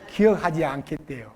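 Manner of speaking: ordinary talk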